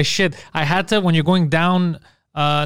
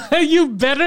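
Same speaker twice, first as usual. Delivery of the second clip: mock taunt; 11 semitones higher, 3 dB louder, slower than usual. Shown as a demonstration. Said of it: "repeating the line mockingly".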